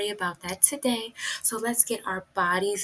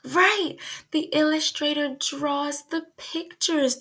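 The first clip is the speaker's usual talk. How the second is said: excited praise; 6 semitones higher than usual. Acted. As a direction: cheer the child's answer. Teacher praising an unheard answer.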